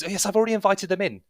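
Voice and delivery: high, quick reply; harried young man. Acted. Young man answers the nagging from inside, harried. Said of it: "And he goes".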